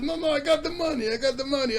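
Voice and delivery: high, hurried mock protest; mock panicked pleading. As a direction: blurt it out like a man caught out. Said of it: "And he's like".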